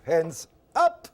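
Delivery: spring the trap with a shout; mock command shout. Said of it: "and I go".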